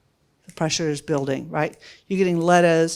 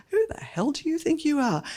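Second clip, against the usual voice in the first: indignant scoff; 9 semitones higher than usual. Retold or imitated. Outraged at the intern's letter.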